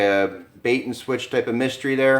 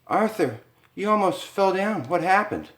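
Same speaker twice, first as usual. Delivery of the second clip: much higher, alarmed wife; alarmed Lisa; 11 semitones higher than usual. Acted. Wife to husband after his near fall, much higher alarmed voice. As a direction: cry out in sudden worry.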